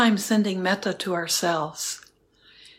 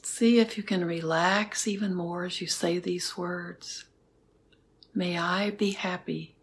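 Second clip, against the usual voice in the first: guided meditation. Acted. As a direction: guide them gently into stillness. Leads a metta meditation.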